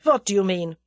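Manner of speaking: put-on rough German-accented demand. A narrator's reading. German turns roughly to Tommy, German accent. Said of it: "turned roughly to Tommy".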